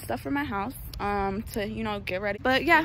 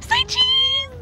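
squeaky shout; 5 dB louder than usual. Acted. Photo call again, louder.

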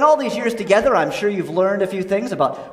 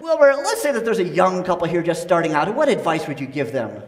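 ordinary talk, nothing marked

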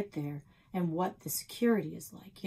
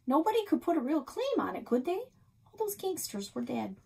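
lightly voiced chatty old woman, higher; a hint of a gossipy diner owner; old Nan; 9 semitones higher than usual. A narrator's reading. Nan reasons aloud.

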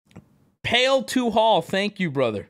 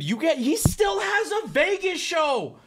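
pitched way up in outrage; high outraged disbelief; 9 semitones higher than usual. Real, not acted. Streamer fuming to chat about Criss Angel, high outraged disbelief. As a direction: howl it like it's unbelievable.

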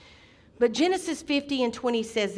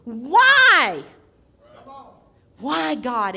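anguished shout of why, much louder; screamed cry to God; 3 semitones higher, 11 dB louder, much slower than usual. Retold or imitated. Grieving father screams at God on the floor, anguished shout. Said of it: "scream to the top of my lungs".

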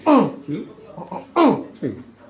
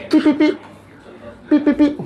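high playful beep-beep noises, much higher; mock beeping; 13 semitones higher, 4 dB louder than usual. Therapist beeping playfully at each press point. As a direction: beep like a little machine while pressing.

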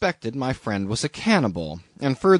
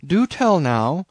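slow, higher mock amazement; sceptical cry; 6 semitones higher, 4 dB louder than usual. A narrator's reading. Captain doubting the newcomer's story, mildly sceptical cry. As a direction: draw it out with mild disbelief. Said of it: "cried Bildad".

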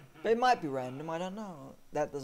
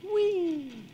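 high delighted squeal; gleeful whee; 7 dB louder than usual. Real, not acted. Player cheering his vehicle on, high squeal.

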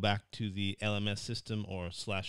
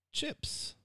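British word. Shown as a demonstration. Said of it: "how do we say this in British English".

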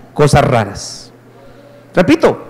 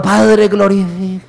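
sleepy mock prayer; slower than usual.

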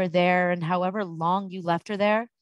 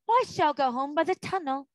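higher-pitched, cool announcement; a touch of frosty dignity; coldly; 7 semitones higher than usual. A narrator's reading. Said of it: "said Polly rather coldly".

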